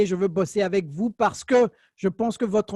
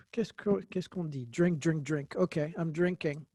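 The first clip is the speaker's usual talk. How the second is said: low, soft talking to oneself; muttered aside; 3 semitones lower, 9 dB quieter than usual. Real, not acted. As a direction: mumble it quietly, as if thinking aloud. Aside to self while drinking water.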